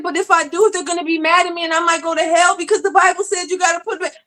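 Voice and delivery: high, fast and tearful, words tumbling out; crying, frightened plea. Retold or imitated. Grieving mother pleading with her pastor, high and crying. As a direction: sob it out in a rush. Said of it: "I said (crying)".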